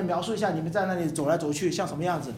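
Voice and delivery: usual speaking voice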